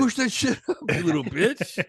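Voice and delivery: high, jeering gym taunt, half laughing; mock spotter yell. Friend mocking his workout partner as a jeering spotter. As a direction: goad him like a rude spotter.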